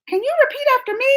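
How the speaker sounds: very high cartoon teacher voice; Dora voice. Vlogger mimics Dora prompting the audience, very high voice. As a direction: chirp it like Dora asking the audience. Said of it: "where you're just like".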